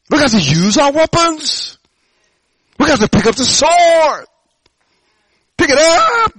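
rally the church to pick up the sword; climbing, shouted sermon call to arms; rousing preacher shout. Acted. Preacher rallying congregation to fight.

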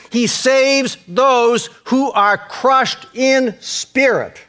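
very slow, every word set down heavily; slow emphatic verse. Shown as a demonstration. Pastor declaring the verse as truth, slow and emphatic. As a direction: drag it out, stressing "saves". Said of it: "The truth is right here".

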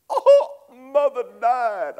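loud mock wail with a laugh; mock mournful. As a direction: overplay the grief for a laugh. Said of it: "You're like".

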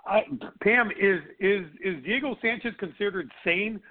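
ordinary delivery

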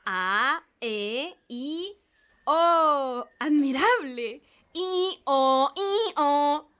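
eager vowels, O loudest; reciting vowels. Acted. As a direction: run through all the vowels proudly. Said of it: "La O se forma".

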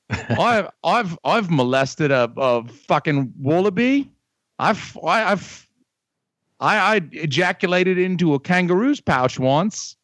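broad mock Australian; Australian accent. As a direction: overdo the Australian bloke. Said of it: "My Australian's gotten much better".

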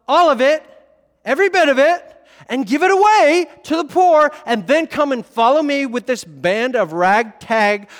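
loud, high, driving pulpit voice; full-force preaching. Acted. Pastor preaching to his church at full force. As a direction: drive it home to the congregation.

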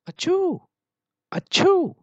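two explosive high "achoo"s; huge mock sneezes. A narrator's reading. Deer demonstrates an elephant's sneeze, loud high bursts. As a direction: sneeze loudly twice, shooting up very high. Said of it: "must have a huge, disgusting sneeze".

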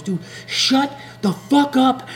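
high, slow imitated outburst; mock exasperation, each word drawn out; snapping. Comic losing it at a repeat heckler, imitated angry snap. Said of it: "Sean snaps".

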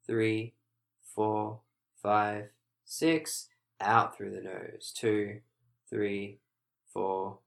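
calm, low, steady counting; guided breathing. Acted. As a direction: count the breaths slowly and evenly.

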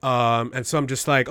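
normal talking voice

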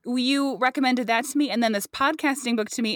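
ordinary talk

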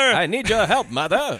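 put-on pleading old Hollywood hero; Cary Grant impression. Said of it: "We're all doing great Cary Grant impressions here".

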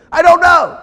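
high, loud, hammed-up wail of "I don't know"; anxious church member wailing to the pastor, hammed up; mock panicked wail. Mock anxious congregant climax.

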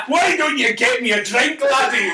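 high-pitched, hammed-up Scottish accent. Panellist imitating Richard Attenborough in Jurassic Park, Scottish accent. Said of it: "he's supposed to be Scottish".

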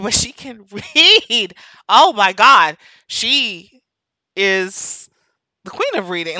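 loud, drawn-out gushing; emphatic admiration. Real, not acted. Praising a reality star's reads, loud gushing. Gushing over Phaedra's reading skill.

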